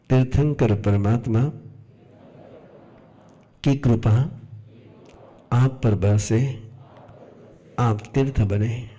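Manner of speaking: unhurried prayerful guidance with long pauses; guided meditation. Acted. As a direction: lead them inward, phrase by phrase, in an even voice. Leads closed-eye forgiveness meditation. (ठहर-ठहर कर ध्यान करवाते हुए)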